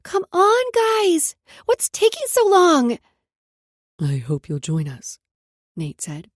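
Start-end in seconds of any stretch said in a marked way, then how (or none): 0.0-3.0 put-on loud high child's call. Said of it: "Brooklyn called out". 4.0-5.2 deep male voice. Acted. Nate's deep voice.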